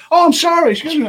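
high, loud mock apology; imitated startled apology. Kid who bumped him with bike, imitated startled apology.

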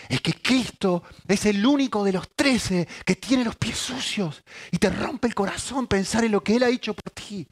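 high, loud, impassioned sermon voice; a pastor pleading from the pulpit; fervent preaching. Acted. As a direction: preach it with full fire. Preaching at full force.

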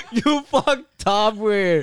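loud, high, slow through laughter; laughing rebuke. Real, not acted. Host teases guest who refused a photo, laughing. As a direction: scold a friend while cracking up.

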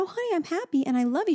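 high, quick mommy voice, retold; reassuring mom. Mom reassuring toddler in retold exchange.

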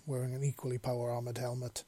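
ordinary delivery, nothing marked

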